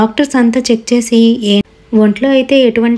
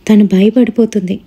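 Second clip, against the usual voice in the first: ordinary delivery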